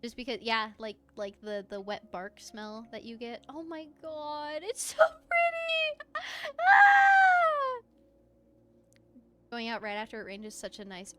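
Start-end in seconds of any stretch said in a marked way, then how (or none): 3.5-7.8 high, loud delighted squeal; excited squeal. Real, not acted. Gamer squealing at a pretty game landscape. Streamer amazed at game scenery.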